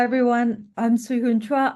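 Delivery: usual speaking voice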